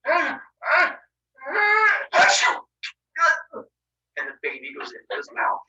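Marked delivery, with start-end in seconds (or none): 0.0-3.7 milk a cartoon sneeze; building fake sneeze. Father faking a sneeze to swallow baby, rising to loud achoo.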